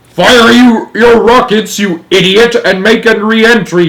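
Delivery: loud, high, furious order; shouting. A narrator's reading. President ordering astronauts home, shouting. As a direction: bawl out the idiot. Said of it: "shouted the president".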